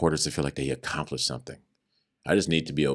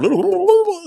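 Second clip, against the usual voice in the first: high laugh; 10 dB louder than usual. Real, not acted. Laugh after inmate line.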